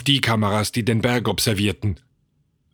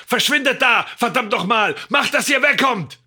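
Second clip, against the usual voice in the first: high, impatient shout; screaming; 13 semitones higher than usual. A narrator's reading. George screaming at men on screen, frantic shout. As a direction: yell it out at the top of the range. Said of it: "schrie den Bildschirm an".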